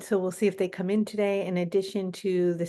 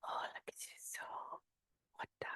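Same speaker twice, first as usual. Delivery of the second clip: whispering; 20 dB quieter, faster than usual. Real, not acted. Whispered aside while channeling.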